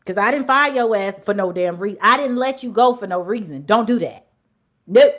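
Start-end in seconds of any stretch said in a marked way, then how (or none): 4.9-5.1 sharp shouted nope; loud nope. Real, not acted. Ending the rant with a loud refusal. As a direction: slam the door shut.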